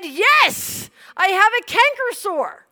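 high, loud blurted answer; comically overdone admission; emphatic confession. Retold or imitated. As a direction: announce a trifle with mock gravity. Retold regretted answer to the boy.

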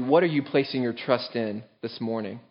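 ordinary talk

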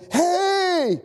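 long, high-pitched hail; calling out. Acted. Marine answering trapped firemen's banging, calling out. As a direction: call to someone buried far off.

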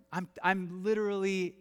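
ordinary delivery

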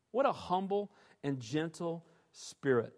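ordinary delivery

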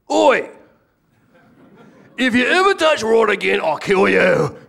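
put-on a lightly done British accent, high and loud, laughing through it; British tough-guy threat. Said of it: "if I take off a British accent".

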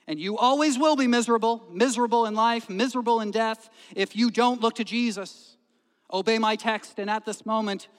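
high, loud, hammering exhortation; impassioned preacher's warning. Retold or imitated. Preacher warning a miserable young stranger, loud and urgent. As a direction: drive the warning home forcefully. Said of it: "He continued".

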